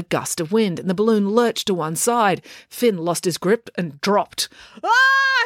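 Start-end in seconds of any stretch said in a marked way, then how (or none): 4.8-5.4 very high acted scream; falling cry. A narrator's reading. Finn losing his grip and dropping, crying out. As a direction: cry out as you drop. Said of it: "he cried".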